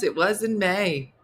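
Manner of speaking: ordinary delivery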